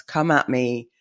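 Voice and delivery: ordinary talk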